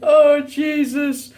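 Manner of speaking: real high, slow exclamation through laughter; helpless laughing groan. Real, not acted. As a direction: gasp it out while still laughing. Cracking up at friend's message.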